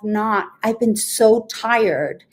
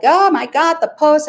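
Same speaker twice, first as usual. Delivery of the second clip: higher, louder sudden alarm at a forgotten post; mock panic; 5 semitones higher, 4 dB louder than usual. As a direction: recall the moment you remember the deadline. Panicking about a late post.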